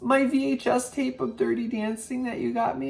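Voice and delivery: high mock mom voice, upset; imitating mom. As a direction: put on mom's high fretting voice. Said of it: "She says".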